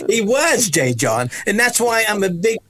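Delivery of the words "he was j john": high emphatic he was; excited exclaim. Real, not acted. To the host, an excited emphatic agreement. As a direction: burst out in agreement.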